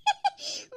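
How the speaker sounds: small satisfied laugh; contented giggle. Acted. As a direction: giggle with a full belly. Contented laugh after the meal.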